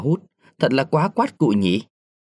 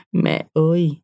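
mildly acted plaintive cry, higher in pitch; whimpering sob; 5 semitones higher than usual. A narrator's reading. Widow unable to hold back her grief, understated whimper. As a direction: let out a small mournful call. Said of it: "chị ti tỉ".

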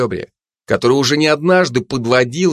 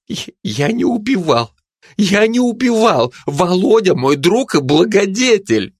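frightened denial; 9 semitones higher than usual. Acted.

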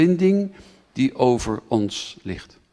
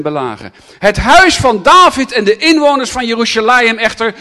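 louder, higher sermon reading; preacher declaring Zechariah to the congregation; proclaiming scripture; 7 semitones higher, 9 dB louder than usual. Acted. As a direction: read it out with force.

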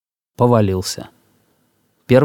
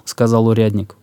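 ordinary delivery, nothing marked

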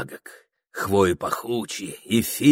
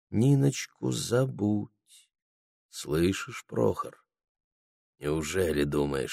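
quiet, sad; 7 dB quieter than usual. A narrator's reading. Анфиса warning Прохор to forget Ниночка, quiet and sad. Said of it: "сказала ему тихо, грустно".

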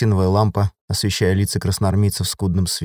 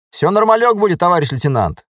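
lightly voiced soldier, pitched high; cheery reassurance; 9 semitones higher, 3 dB louder than usual. A narrator's reading. Driver assures his lieutenant before the operation, confidently. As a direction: assure the officer in a high, easy voice. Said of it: "заверил он Максима".

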